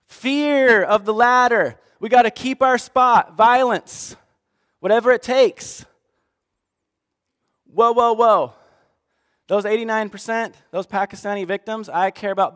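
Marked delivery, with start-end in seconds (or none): 0.0-5.8 loud slow mock greed. Voicing the ladder-climbers' fear. 7.7-8.5 loud alarmed whoa. Acted. God stopping the speaker.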